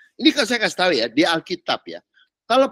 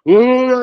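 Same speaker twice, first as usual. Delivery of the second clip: loud, higher straining groan; mock groan of forcing out belief; exaggerated pushing noise; forced belief groan; 6 semitones higher, 8 dB louder than usual. Shown as a demonstration. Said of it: "maksa seakan-akan mau kelihatan percaya".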